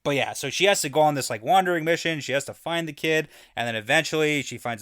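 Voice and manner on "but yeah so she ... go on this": ordinary delivery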